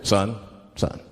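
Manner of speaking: deep-voiced officer at a traffic stop; police officer. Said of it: "the police pull me over".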